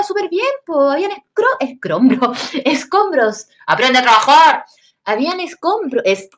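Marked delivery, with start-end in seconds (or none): 3.7-4.7 louder scolding line; mock scolding; sharp retort. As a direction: tell her off. Mocking retort.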